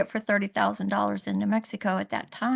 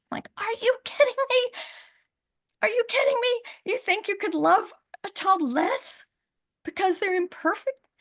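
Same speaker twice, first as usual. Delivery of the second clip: higher, incredulous and indignant; outraged disbelief; 11 semitones higher than usual. Real, not acted. Mother recalling the nurse's words, outraged and much higher. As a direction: throw the question back in disbelief.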